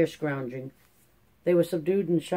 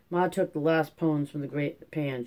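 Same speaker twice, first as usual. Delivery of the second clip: ordinary delivery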